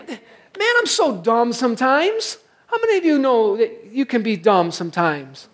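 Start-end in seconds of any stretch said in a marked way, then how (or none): 0.1-2.4 high, put-on voice, laughing at his own foolishness; mock self-scolding. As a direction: play the sheepish sinner with a laugh. Mock sinner scolding himself.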